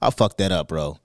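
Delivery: low, soft, crushed voice; low, quiet mock despair; distraught confession. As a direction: mumble it like you blew everything. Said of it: "I'd be like".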